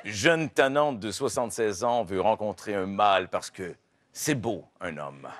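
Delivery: low, serious documentary voice; documentary narrator. Acted. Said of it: "avec ma voix de documentaire".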